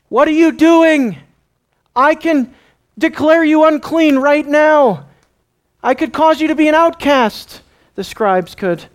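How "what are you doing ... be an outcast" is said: high, loud, indignant scolding; angry threat. Acted. Scribe confronting Peter outside, angry threat. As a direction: threaten him with your authority, voice raised high.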